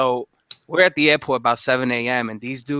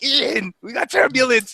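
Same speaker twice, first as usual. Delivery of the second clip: higher, teasing fake warning; mock alarm; 11 semitones higher than usual. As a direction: pretend to be the crew announcing danger. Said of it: "I'm like".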